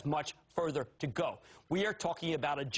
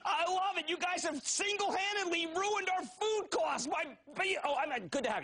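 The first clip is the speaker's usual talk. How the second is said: excited shout; 12 semitones higher than usual. Real, not acted. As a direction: gush over the caller. Host fired up by caller.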